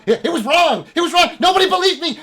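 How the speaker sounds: high, loud frantic rant; wild vindicated outburst; insane elf. Acted. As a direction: shriek it like a crazed prophet. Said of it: "fucking insane elf".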